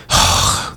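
mock huffy exhale; exasperated sigh. Partner reacting to being asked for time, huffy sigh. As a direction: let out an unvoiced huff. Imitating partner's huff when refused.

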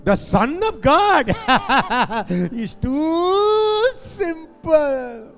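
high laughing, drawn out. Real, not acted. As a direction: laugh through the words. Laughing mock disbelief.

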